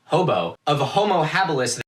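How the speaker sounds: ordinary talk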